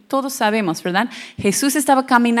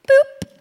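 playful boop; 7 dB louder than usual. Pretend. Tossing her fear to God.

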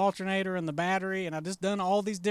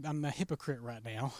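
hushed confession; 6 semitones lower, 8 dB quieter than usual. Retold or imitated. Confessing in retold prayer.

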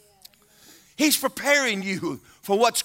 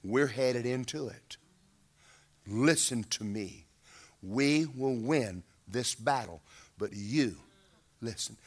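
commander's low warning; 6 semitones lower, 6 dB quieter than usual. Acted. Battlefield commander warning a rider, low and grave.

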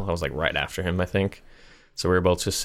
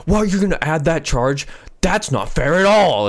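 higher, louder mock outraged yell; imitated yelling; 9 semitones higher, 5 dB louder than usual. Old customer yells at the cashier over the charge, mock angry. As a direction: ham up an old man's indignant shout. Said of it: "he just starts fucking yelling".

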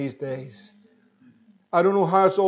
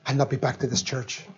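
put-on scandalised young woman, breathy hush; hushed, appalled church girl; shocked young woman; 4 semitones lower, 7 dB quieter than usual. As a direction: whisper it as if appalled. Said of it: "She says".

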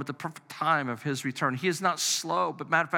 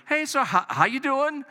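much higher, mock-sweet "how you doing"; a pointed, too-innocent check-in call; loaded greeting; 9 semitones higher than usual. Husband phoning his wife after four hours, mock-sweet and pointed. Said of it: "my tone probably even says".

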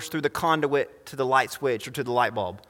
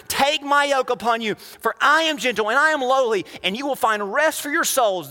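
high, impassioned scripture declamation; preaching scripture; 9 semitones higher than usual. Acted. Preacher voicing Jesus's invitation to the congregation, high declamation. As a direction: proclaim it high, stressing key words.

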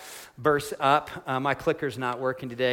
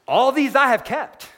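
higher, louder, proud and sure of himself; cocky young man; 9 semitones higher, 6 dB louder than usual. Retold or imitated. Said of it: "real serious and probably a little bit cocky".

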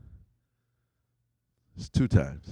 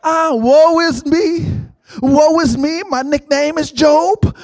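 higher, slow, louder whine of woe; mock self-pity; 7 semitones higher, 4 dB louder than usual. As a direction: ham up a pity party. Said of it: "time for a pity party".

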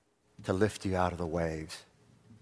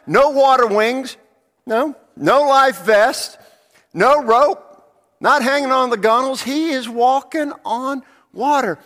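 much higher and much louder declaiming; shouting from the pulpit; full-force preaching; 14 dB louder than usual. Acted. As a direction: hammer each point home.

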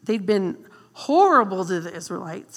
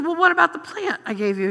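God teasing; 6 semitones higher, 5 dB louder, faster than usual. Retold or imitated. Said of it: "he says".

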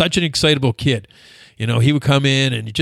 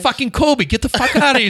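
high-pitched comic outrage; mock exasperation; 10 semitones higher than usual. Retelling dieting bodybuilders chasing Kobe out, high mock exasperation. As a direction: imitate fed-up lifters cursing him out.

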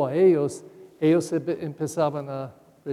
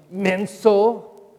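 imitated scolding father; 2 semitones higher, 6 dB louder, much slower than usual. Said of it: "mi papá dijo a mí".

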